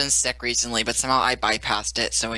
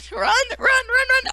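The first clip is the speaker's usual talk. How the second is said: very high frantic cries; frantic urging; 6 dB louder, slower than usual. Real, not acted. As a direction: urge them on frantically. Urging fleeing teammate.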